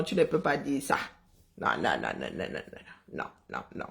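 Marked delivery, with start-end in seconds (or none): 1.6-3.9 far lower, fading to near silence; a sinking string of "non" gone very low and soft; "non" repeated in a dropping, vanishing voice; mock deep no. Mock refusal to Amiral Fouda.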